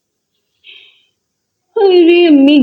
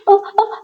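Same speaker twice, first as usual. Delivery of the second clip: गिरने की चीख; 6 semitones higher, 4 dB quieter, much slower than usual. Acted. दादी गिरते हुए चीखती.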